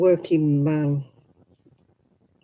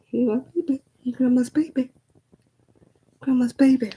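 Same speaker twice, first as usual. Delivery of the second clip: higher sing-song coo; 7 semitones higher than usual. Real, not acted. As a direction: dote on the little dog. Cooing at the pet Rosie.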